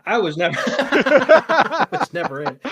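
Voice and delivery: high, loud line broken by laughter; cracking up while repeating the joke; laughing hard through the punchline. Real, not acted.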